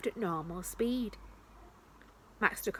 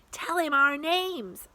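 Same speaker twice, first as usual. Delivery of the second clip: high, slow eager urging; excited; 8 semitones higher, 5 dB louder, slower than usual. A narrator's reading. Sasha excitedly urging Max, high and slow. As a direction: push the idea eagerly. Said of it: "Sasha said excitedly".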